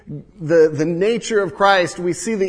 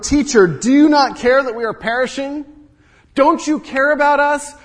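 higher and louder, desperate accusing plea; frantic rebuke; 9 semitones higher, 7 dB louder than usual. Acted. Disciples in the sinking boat rebuke Jesus, loud and desperate. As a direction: scold the sleeping teacher in panic. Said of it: "their words here are significantly a rebuke".

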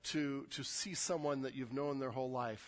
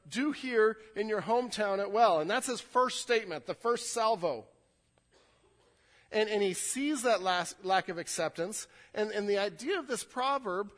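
loud, driving preacher voice; raised pulpit delivery, pressing each line; fervent preaching; 7 semitones higher, 7 dB louder than usual. Acted. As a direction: preach it out to the whole room. Preaching the text at full force.

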